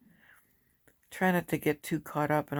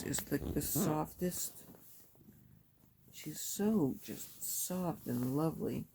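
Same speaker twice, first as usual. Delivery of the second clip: tender; 7 dB quieter, slower than usual. Real, not acted. Cooing over the soft cat.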